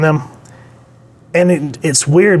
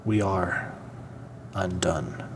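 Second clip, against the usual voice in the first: low, hushed, dying-away pronouncement; doomed hero line; 5 semitones lower, 14 dB quieter, slower than usual. Retold or imitated. Reviewer voicing Strange's grim line, low and fading. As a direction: let it sink low, softer and softer.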